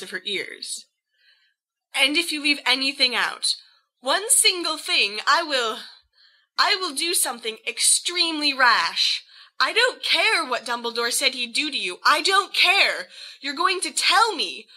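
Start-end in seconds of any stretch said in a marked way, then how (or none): 1.9-12.0 put-on higher, tight rising fury at a reckless boyfriend; furious Lily. 12.0-13.1 higher, louder, slow I don't care; furious I don't care. Acted. Lily furious in story.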